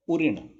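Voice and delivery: उऋण with a plain, short "ri"; wrong ऋ pronunciation. Shown as a demonstration. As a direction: say उऋण loudly as "u-rin", untrilled. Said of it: "उऋण नहीं होता".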